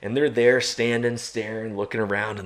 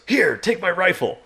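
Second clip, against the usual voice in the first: higher, louder urgent offer; urgent handing over; 10 semitones higher, 5 dB louder than usual. Retold or imitated. Tony handing his rifle mid-chase, urgent higher call. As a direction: thrust the rifle over quickly. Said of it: "He goes".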